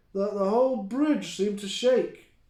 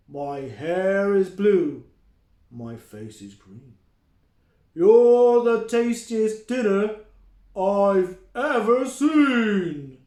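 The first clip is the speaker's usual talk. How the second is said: slow, loud troll chant; menacing troll; 3 semitones higher, 10 dB louder, slower than usual. Acted. The troll gloats over his dinner, slow and loud. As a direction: draw out the rhyme like a hungry troll.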